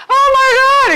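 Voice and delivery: high loud shocked mom; shocked gasp. Retold or imitated. Mom finding the Playboy magazine, high shocked cry. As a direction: shriek it like a mother finding the magazine. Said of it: "his mom ... finds the Playboy magazine and goes".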